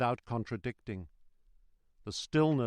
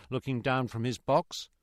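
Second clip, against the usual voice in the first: ordinary delivery, nothing marked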